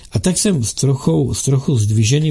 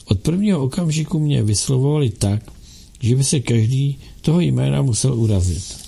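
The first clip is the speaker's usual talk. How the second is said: ordinary delivery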